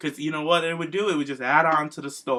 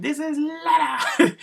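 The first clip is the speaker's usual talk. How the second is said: higher, slow put-on Rusev voice with a laugh; Rusev impression; 12 semitones higher, slower than usual. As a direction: ham up Rusev presenting the fish, much higher and slower. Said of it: "he's like".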